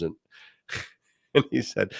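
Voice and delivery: ordinary delivery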